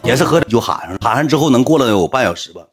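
ordinary delivery

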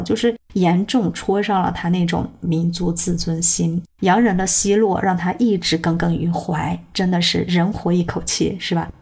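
usual speaking voice

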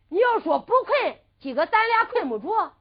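loud, high excited retort; spirited declaration. Acted. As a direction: burst out with the idea, pitch lifted. Excited after getting an idea.

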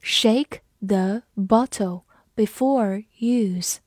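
slow, clear "Shake the bottle before use"; slow read-along. Shown as a demonstration. Teacher slowing the example for the class. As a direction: read it slowly for learners. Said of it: "这句话".

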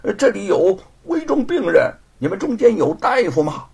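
loud, unabashed appeal; calling out loudly. A narrator's reading. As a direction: call out boldly to a group. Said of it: "大声地说".

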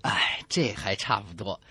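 normal talking voice

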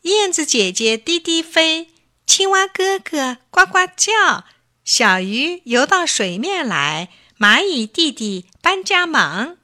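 put-on bright, sing-song children's verse in a high bunny voice; rhyming recitation. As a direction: chant the little rhyme proudly to mom. Bunny recites rhyme to mother.

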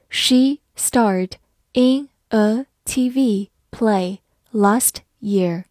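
very slow, each word spelled out clearly; slow reading. Shown as a demonstration. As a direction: read it slowly for learners to follow. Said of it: "好，慢慢来读". (慢速跟读示范)

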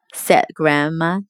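usual speaking voice

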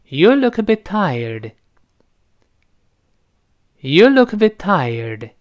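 slow "You look a bit tired", "look a" linked, t of "bit" held; linking, unreleased t. Shown as a demonstration. Students repeat after teacher, "look a bit tired" linked. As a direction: link "look a", hold back the t before a drawn-out "tired". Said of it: "前面是连读".

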